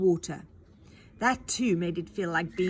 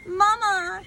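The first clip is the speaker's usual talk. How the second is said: high, loud desperate call; crying out; 11 semitones higher, 8 dB louder than usual. Acted. Boy calling after his mother being dragged off, loud cry. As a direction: call after her as she's taken. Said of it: "cried Alfred after her".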